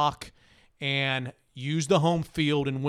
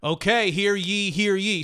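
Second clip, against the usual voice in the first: town crier; 4 semitones higher than usual. Acted. Mock town crier opening ad read.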